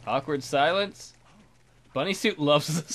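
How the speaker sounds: ordinary talk, nothing marked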